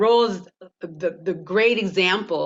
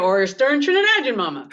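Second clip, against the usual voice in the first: laughing; 7 semitones higher, 4 dB louder, faster than usual. Real, not acted. Laughing at stereotyped roles offered.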